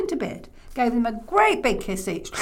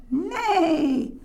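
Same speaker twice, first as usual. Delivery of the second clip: high, drawn-out neigh; whinny like a horse; 8 semitones higher than usual. Acted. Imitates the horse.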